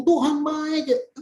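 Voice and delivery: slow, drawn-out mock "Tuhan baik"; sing-song teasing imitation of a cliché; mock churchy filler. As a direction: parody a worship leader's empty phrase. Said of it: "bukan kamu tambah-tambah ngomong".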